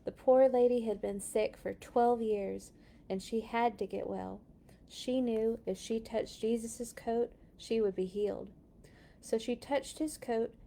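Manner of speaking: usual speaking voice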